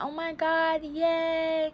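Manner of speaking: high, lightly mocking happy squeal; excited squeal. Boyfriend thrilled about the baby, a mock-giddy squeal. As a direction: imitate his giddy cheer. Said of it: "he starts getting excited".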